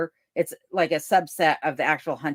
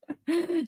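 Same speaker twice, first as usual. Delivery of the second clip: real laugh; 11 semitones higher, 5 dB quieter than usual. Real, not acted. Laughs at Ed's comment.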